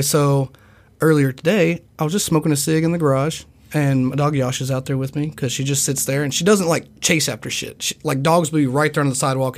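ordinary talk

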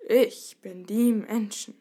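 slow, loud, wary dragon; suspicious. A narrator's reading. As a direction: draw it out with distrust. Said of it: "antwortete die misstrauisch". (misstrauisch und langsam)

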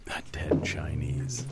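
whispered. Real, not acted. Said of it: "quietly repeating the line".

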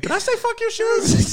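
very high, loud, slow squeak; hammed-up squeal of sudden innocence; panicked mock backpedal. Retelling going back to the gunman with wipes, frantically apologetic.

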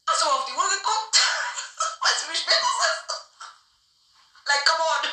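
loud, high outraged retort; indignant scolding. Retold or imitated. Scolding the woman who called at night, outraged and loud. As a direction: tell her off for calling at two.

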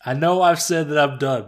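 ordinary delivery

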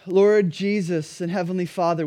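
normal talking voice

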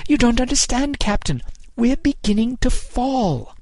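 high, urgent cry, drawn out at the end; alarmed warning. A narrator's reading. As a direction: plead with the captain over the gale. Sexton urgently warns the captain.

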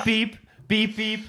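laughing beep beep; beeping imitation. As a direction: imitate a phone line cutting off. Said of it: "hörst du plötzlich das Piep".

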